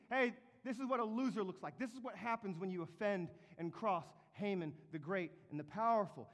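high, loud mock town-crier call; herald's proclamation. Heralds shouting before the city, high loud mock proclamation. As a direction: announce it to the whole square. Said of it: "supposed to be shouting".

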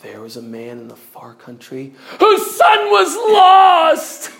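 hushed opening exploding into a high, drawn-out shout; overdone preacher's leap in volume; sudden jump to shouting. Shown as a demonstration. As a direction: jump too far, too soon into a wail. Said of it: "I did not say".

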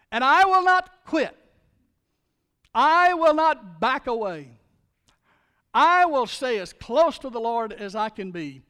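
loud, rising declaration, slow at the peak; Paul's defiant vow. Retold or imitated. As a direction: shout his resolve to never quit. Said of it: "Paul said it like this".

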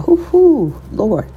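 real emotion breaking through in a high cry; overcome, let out a high Hoo-hoo; getting emotional. Real, not acted. Overcome with feeling while telling listeners her story, emotional cry. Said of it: "I'm getting emotional right now".

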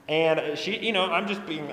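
ordinary talk, nothing marked

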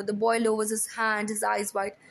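ordinary delivery, nothing marked

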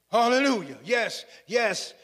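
usual speaking voice

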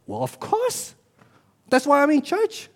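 voicing the congregation, high and self-evident; congregation's obvious answer. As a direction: answer as a churchgoer, pitch lifted well up. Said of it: "Your answer will be".

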